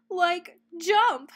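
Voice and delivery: Shaggy cheering on Scooby; excited Shaggy. A narrator's reading. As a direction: urge the leap. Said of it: "said Shaggy".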